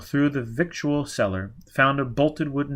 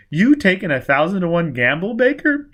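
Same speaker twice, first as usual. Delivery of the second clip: loud, higher scoffing challenge; mocking jeer; 7 semitones higher, 7 dB louder than usual. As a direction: taunt him for being a fool. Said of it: "Quat laughed".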